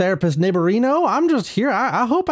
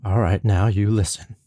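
low smooth narration, pitched way down; Morgan Freeman; 14 semitones lower than usual. Acted. Narrator tells the therapist a family story, deep and smooth. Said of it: "Morgan Freeman".

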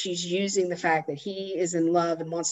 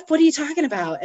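higher-pitched mock disbelief; retold bewilderment; 9 semitones higher, faster than usual. Husband hears about the lamp blowing up, mock bewildered. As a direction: question it like a baffled husband.

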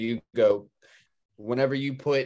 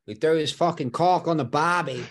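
higher-pitched mock Australian accent; 5 semitones higher, 3 dB louder than usual. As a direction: put on a comic Australian accent. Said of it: "do it in an Australian accent".

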